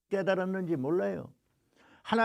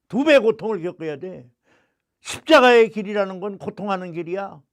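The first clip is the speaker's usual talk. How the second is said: louder, insistent divine command; loud God's voice; 3 semitones higher, 5 dB louder than usual. Retold or imitated. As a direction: hammer each doubling line. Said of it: "주시는 마-, 음성이".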